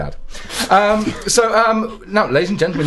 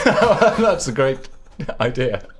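slow words broken by laughs; laughing; much slower than usual. Real, not acted. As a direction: laugh through the line.

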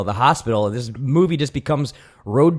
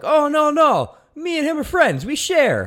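put-on Mexican accent; slower than usual. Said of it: "the guy's just like".

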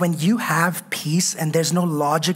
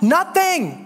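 NOTHING said high with a falling finish; emphatic nothing; 8 semitones higher than usual. Acted. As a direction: land the word nothing high and final. Dismissing each worry in prayer.

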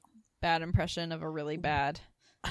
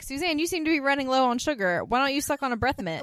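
higher and louder, mock dry sarcasm; imitating Julia; 7 semitones higher, 5 dB louder than usual. Julia needling Suzanne, higher and sarcastic. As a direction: needle her with cool politeness. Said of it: "Julia's like".

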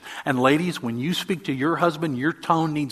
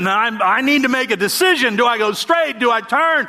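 stressed retelling; 8 semitones higher, 5 dB louder than usual. Retold or imitated.

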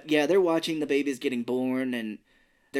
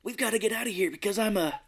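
mock kid line; 7 semitones higher than usual. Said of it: "where he's like".

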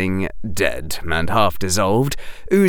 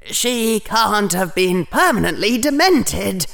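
higher-pitched desperate thought; 11 semitones higher than usual. A narrator's reading. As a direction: think it in desperate denial. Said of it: "Harry thought desperately".

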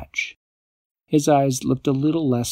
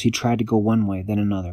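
usual speaking voice

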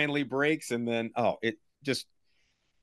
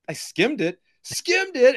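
mock squeak; 12 semitones higher, 4 dB louder, slower than usual. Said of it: "He's like".

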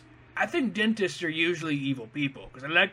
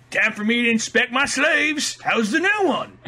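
mock Santa voice; 6 semitones higher, 4 dB louder than usual. Voicing Santa inspecting elves.

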